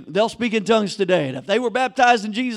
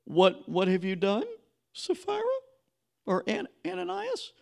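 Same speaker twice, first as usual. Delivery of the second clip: Peter questioning; 6 semitones higher, 7 dB quieter than usual. Retold or imitated. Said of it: "It was like".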